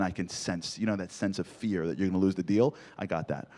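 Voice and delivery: ordinary talk, nothing marked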